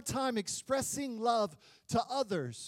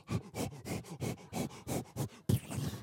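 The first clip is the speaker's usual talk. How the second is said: rapid voiceless panting like a dog; huffing dog impression; imitated dog panting; 9 dB quieter than usual. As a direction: pant fast and breathy, right up close. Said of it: "next to my face going".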